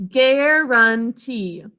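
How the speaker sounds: "Guarantee" stretched slowly syllable by syllable; teacher sounding out a word for kids, slow. Shown as a demonstration. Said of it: "Let's sound that out together".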